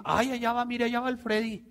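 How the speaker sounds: high, pointed neighborhood gossip; mocking gossip. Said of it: "cuántas veces nos dijeron". (chismosa burlona, aguda)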